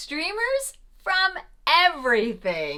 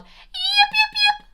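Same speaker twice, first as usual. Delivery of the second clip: very high, loud little yips; a little fox calling out across the hill toward the music; fox yipping call; 7 dB louder than usual. Acted. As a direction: call out like a small fox into the night. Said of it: "She called".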